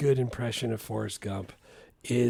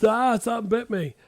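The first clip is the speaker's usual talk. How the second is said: Forrest quote; 9 semitones higher, 3 dB louder than usual. Retold or imitated. Quoting Forrest's line.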